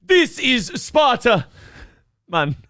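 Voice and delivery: slow, high comic Jamaican voice; Jamaican accent. Acted. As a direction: stretch it out in Jamaican. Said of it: "you should be from Jamaica".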